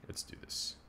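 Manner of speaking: whispered to himself. Real, not acted. Streamer gearing up for the next match, whispered. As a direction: psych yourself up under your breath. Quiet psych-up before match.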